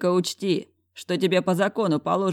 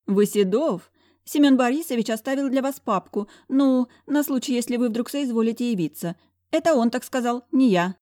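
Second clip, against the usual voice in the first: higher, fawning receptionist voice; fawning secretary; 8 semitones higher, 3 dB louder than usual. A narrator's reading. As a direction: simper like a toadying secretary. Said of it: "Залебезила секретутка".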